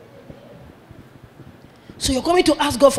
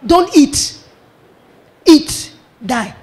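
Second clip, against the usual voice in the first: each word "Don't eat, eat, die" punched out, louder, with a pause between; emphatic commands; 4 dB louder, much slower than usual. Retold or imitated. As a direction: hammer "Don't eat", "eat" and "die" apart like three orders. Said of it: "three things God said to him".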